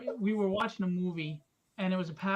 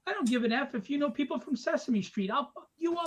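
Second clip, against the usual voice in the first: higher-pitched mock tough-guy threat; wisecracking comeback, pitched up and rising as it goes; retold threat; 6 semitones higher than usual. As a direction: rattle off a heated street threat. Retold threat to street guys.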